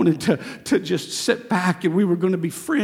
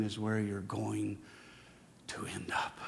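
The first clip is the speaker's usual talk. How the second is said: whisper; 9 semitones lower, 15 dB quieter than usual. Acted. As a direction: fade to a whispered end. Whispered sentence end.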